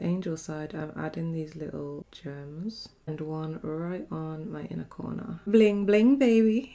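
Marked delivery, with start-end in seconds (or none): 5.5-6.7 loud, higher, showy cheer; bling bling. Real, not acted. To viewers about her gems, a loud showy cheer. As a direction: show off the sparkle proudly.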